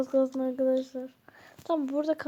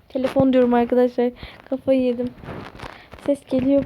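exasperated loud outburst; 9 dB louder, faster than usual. Real, not acted. Phone interrupts while cooking.

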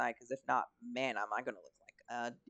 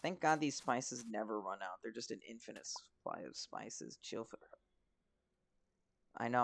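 normal talking voice

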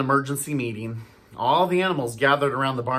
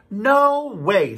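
a loud, higher no as the stern farmer; firm refusal; 8 semitones higher, 6 dB louder than usual. Acted. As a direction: shut the idea down hard. Farmer refuses cows' demand.

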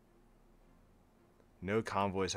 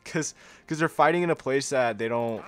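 excited emphasis; 7 semitones higher, 11 dB louder than usual. Real, not acted. Laughing complaint about Africa.

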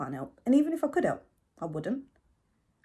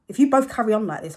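louder, higher ultimatum; fed-up threat; 5 semitones higher, 11 dB louder than usual. Acted. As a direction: warn them, fed up. Threatening to leave the room.

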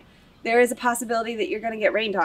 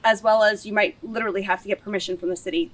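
ordinary delivery

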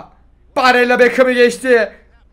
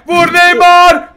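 loud, high yell to shoot; shouting at the game; 8 semitones higher, 9 dB louder, much slower than usual. Real, not acted. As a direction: urge him on at full voice. (maça bağırarak tezahürat)

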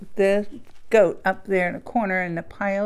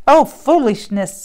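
a hint of a scoffing old goat, louder; grumpy goat; 4 semitones higher, 10 dB louder than usual. Goat dismissing Christmas fuss, mild grumpy scoff.

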